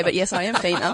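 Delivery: husky voice with a laugh. Real, not acted. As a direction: let the husky voice laugh a little. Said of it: "I have got a husky voice today".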